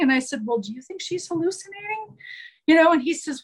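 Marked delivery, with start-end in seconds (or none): 0.4-2.2 soft probing question. Retold or imitated. Nurse turns question back softly.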